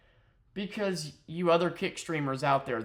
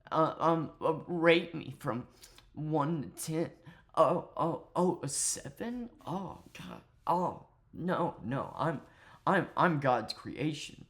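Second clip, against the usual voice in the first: mock vain streamer; 4 semitones higher than usual. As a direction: imitate a vain streamer fishing for praise. Imitating the streamer's rating bit.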